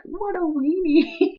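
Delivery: high, slow, loud teasing scoff; mocking own future self. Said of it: "look back on this one day and be like".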